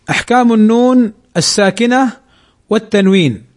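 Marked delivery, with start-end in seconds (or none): none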